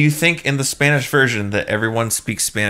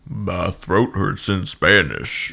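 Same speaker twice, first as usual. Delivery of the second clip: slow, drawn-out mock deep voice, noticeably lower; 3 semitones lower, much slower than usual. Friend guessing how the Spanish tape guy sounded, slow and low. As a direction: stretch it out low like a hammed-up narrator. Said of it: "I was really thinking it was gonna sound like".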